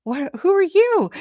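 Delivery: high, surprised little cry of discovery. Retold or imitated. Mother spotting a kitten in a tree, high surprised cry. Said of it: "And I'm like".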